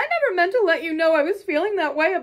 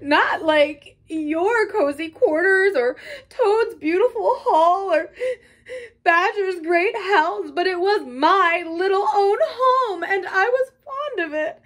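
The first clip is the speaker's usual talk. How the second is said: high, loud tearful wailing; sobbing brokenly; 6 semitones higher, 4 dB louder than usual. A narrator's reading. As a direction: pour it out through acted sobs, voice pitched up. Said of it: "he sobbed forth at last brokenly".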